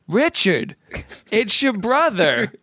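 high, slow, posh English gentleman; slow stately English elder; English old man. Retold or imitated. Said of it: "dignified, kind of English-speaking older man".

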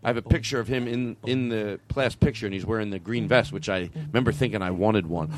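usual speaking voice

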